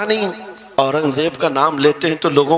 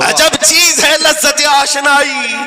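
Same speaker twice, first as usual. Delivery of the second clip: high, slow, ringing recital; impassioned poetic declamation; declaimed verse; 10 semitones higher, 4 dB louder, slower than usual. Real, not acted. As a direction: proclaim the verse to the crowd, drawn out. Same verse repeated as declamation.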